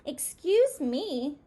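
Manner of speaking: slow, lightly voiced polite mouse; a small mouse interrupting politely; polite little mouse. A narrator's reading. Mouse politely addresses beetle.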